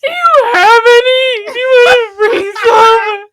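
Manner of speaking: high, loud tearful plea; loud weepy question; crying plea. Retold or imitated. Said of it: "and I'm like".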